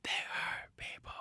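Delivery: slow, hushed whisper, hurt drawn out; menacing whisper. Acted. Host whispers the Hurt Business motto to his co-host, slow and menacing. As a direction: whisper it like a threat.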